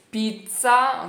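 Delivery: loud "Pizza" with a hard ts sound; strong tz in pizza. Shown as a demonstration. Teacher showing the strong z, loud and crisp. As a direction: punch the ts in "Pizza". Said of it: "É mais forte assim".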